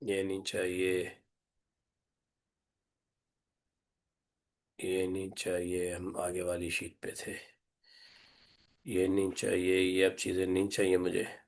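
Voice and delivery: low, soft, level self-talk; muttering to oneself. Real, not acted. Teacher to self while deleting unwanted things, muttering low. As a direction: murmur as if busy with a task.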